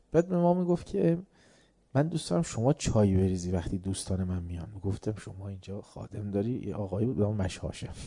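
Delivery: normal talking voice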